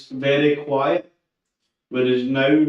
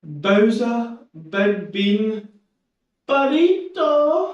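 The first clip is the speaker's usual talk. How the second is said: pet talk; 8 semitones higher, much slower than usual. Real, not acted. Greeting the pet by nicknames.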